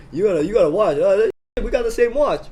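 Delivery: loud, high-pitched teasing; making fun. Retold or imitated. Teasing an annoyed stranger on the train, loud mockery. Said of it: "start making fun of him".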